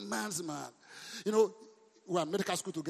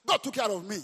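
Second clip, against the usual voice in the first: ordinary delivery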